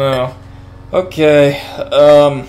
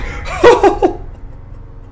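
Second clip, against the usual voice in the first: nervous laugh. Real, not acted. Relief after scare.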